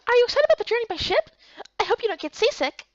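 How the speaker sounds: high, quick chirpy voice; bubbly little companion; perky girl character. Acted.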